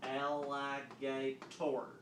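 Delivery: AL-LI-GA-TOR read slowly, section by section; busted-up syllables. Shown as a demonstration. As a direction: read "Alligator" as four drawn-out, separate chunks. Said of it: "read these three busted up sections".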